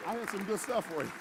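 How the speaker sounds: bright laughing aside. Real, not acted. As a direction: talk through the giggles. Laughing off his own bit.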